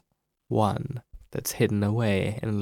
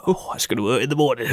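quoting the character; faster than usual. Retold or imitated.

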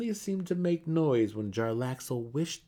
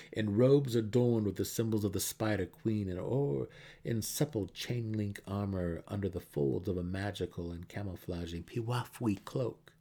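plain reading voice

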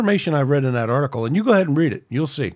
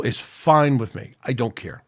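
usual speaking voice

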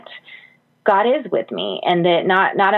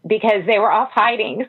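ordinary talk

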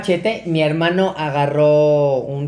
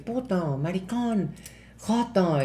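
insultos en voz baja; 9 dB quieter, slower than usual. Retold or imitated. Drunk man muttering insults at him.

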